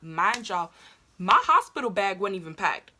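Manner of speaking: normal talking voice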